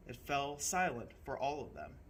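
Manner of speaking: ordinary delivery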